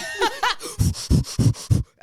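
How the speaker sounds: airy high laughter; breathy laugh. Real, not acted. Laughing at birth joke.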